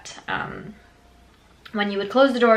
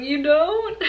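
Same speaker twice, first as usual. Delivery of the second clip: high-pitched mimicked question; mock disbelief; 8 semitones higher than usual. Brother learning police have no key, high mock disbelief. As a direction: squeak it like a let-down kid. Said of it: "And he's like".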